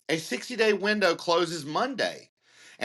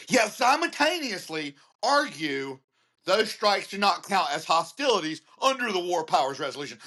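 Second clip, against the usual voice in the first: ordinary delivery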